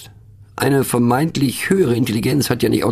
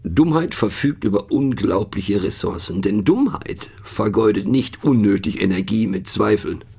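ordinary delivery

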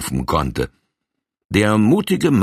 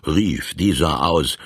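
ordinary delivery

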